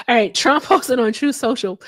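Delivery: ordinary talk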